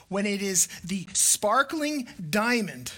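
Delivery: ordinary delivery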